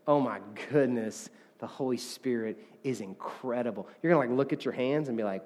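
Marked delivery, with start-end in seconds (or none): 0.0-3.9 low, hushed mock amazement; imagined awestruck murmur; overwhelmed, mildly acted. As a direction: act out being quietly overcome. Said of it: "start crying or freaking out and going".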